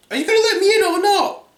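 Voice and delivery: fast, loud, very high falsetto; impatient wolf keeping up the girly voice. A narrator's reading.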